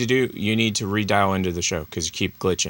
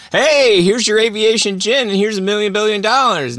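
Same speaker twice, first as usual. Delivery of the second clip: mock high voice; 12 semitones higher, 5 dB louder than usual. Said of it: "it's like".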